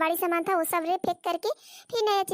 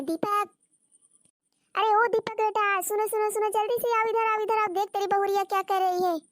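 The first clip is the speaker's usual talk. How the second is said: raised, higher-pitched call to a son; 4 semitones higher than usual. Acted. Mother summons son Deepak to see his wife's doings, calling out. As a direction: call him over urgently, pitched up. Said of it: "इतना जोर जोर से क्यों चिल्ला रही हो".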